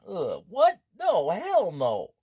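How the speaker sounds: loud, slow hell no; disgusted refusal. Real, not acted. Player refusing the awful fish, loud and slow. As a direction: recoil from the taste.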